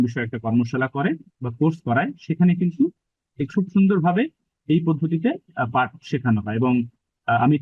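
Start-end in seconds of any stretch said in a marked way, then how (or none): none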